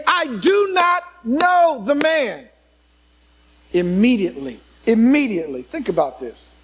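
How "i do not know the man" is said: slow, high, forceful oath of denial; cursing denial. Retold or imitated. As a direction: spit the denial out like a cornered man swearing. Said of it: "He cursed and swore, saying".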